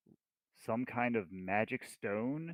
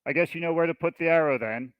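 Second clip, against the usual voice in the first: loud, raised in-character call; higher, projected signal across a distance; 6 semitones higher, 8 dB louder than usual. Acted. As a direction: call it out to a hidden ally. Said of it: "I'm gonna call out and say".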